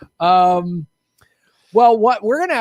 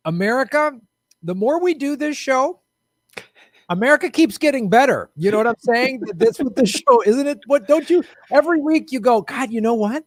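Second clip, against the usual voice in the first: sarcastic; 6 semitones higher, 4 dB louder than usual. Acted. Mock patriotic praise of America.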